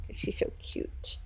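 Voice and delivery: soft whispered aside. Real, not acted. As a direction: whisper it fondly.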